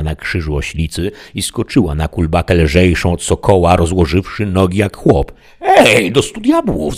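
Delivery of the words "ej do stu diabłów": high, loud amazed exclamation; Sancho calling out. A narrator's reading. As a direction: burst out in astonishment. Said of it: "zawołał Sancho".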